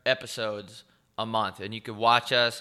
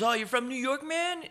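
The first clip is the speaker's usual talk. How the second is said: higher, rising put-on voice of an eager driver; imitating a nosy driver; curious driver. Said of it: "He goes".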